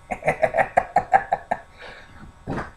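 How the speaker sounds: soft unvoiced wheeze of laughter; breathy silent laugh. Real, not acted. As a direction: laugh without voice, air only. Silent laugh after gift.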